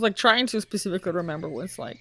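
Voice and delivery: ordinary delivery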